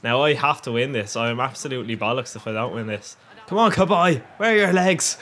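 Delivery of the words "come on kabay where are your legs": urging shout. Real, not acted. Urging his player.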